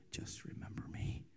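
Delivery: a soft unvoiced whisper, barely there; whispered prayer. Mother turning to God in hardship, whispering. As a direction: breathe it like a private prayer. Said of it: "she would turn to her left and she would say".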